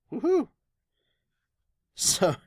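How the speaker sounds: ordinary talk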